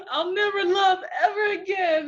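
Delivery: high, laughing fake wail; mock heartbreak. Middle-schooler rejected by her crush, mock sobbing and laughing. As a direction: ham up teenage heartbreak, half laughing. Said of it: "And I was like".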